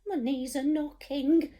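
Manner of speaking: high, trembling scared cook voice. Acted. Storyteller voicing the cook, scared of the sea, high voice. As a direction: quiver like a frightened wimp. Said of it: "the cook declared".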